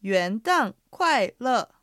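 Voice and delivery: slow, syllable-by-syllable repeat; slow model pronunciation. Shown as a demonstration. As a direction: stretch every tone out for learners. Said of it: "発音と合わせて言ってみましょう". (一音ずつゆっくり復唱)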